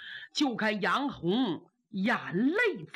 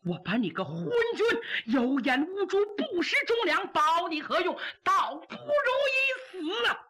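cursing the emperor; 7 semitones higher than usual. Acted. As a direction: spit it at the tyrant on the way to death. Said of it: "一边走一边骂呀".

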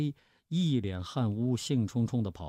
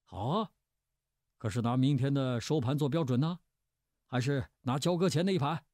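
higher-pitched, pressing challenge; argumentative, raised-pitch questioning; bickering comeback; 5 semitones higher than usual. A narrator's reading. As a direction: take up the dare and push back. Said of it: "一句紧一句地吵".

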